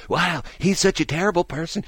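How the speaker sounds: mock scolding. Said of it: "she's just excoriating me".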